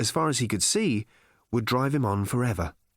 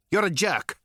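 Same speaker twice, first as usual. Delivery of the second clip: higher-pitched officious alien verdict; curt insult; 6 semitones higher than usual. A narrator's reading. Alien insulting Arthur, curt and officious. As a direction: pronounce the insult like an official finding.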